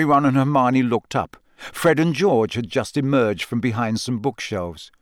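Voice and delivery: ordinary delivery, nothing marked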